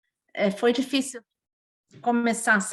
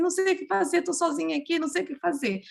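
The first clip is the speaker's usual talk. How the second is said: high-pitched, imitated helpless pleading; retold tearful helplessness; 6 semitones higher, faster than usual. Mother alone with choking baby calling friends, retold helpless plea. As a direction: re-enact not knowing what to do, plaintively. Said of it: "Liguei chorando pra eles".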